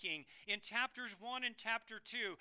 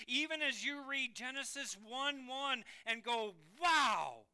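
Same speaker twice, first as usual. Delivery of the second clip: preaching at full force; 4 semitones higher, 7 dB louder, slower than usual. Acted.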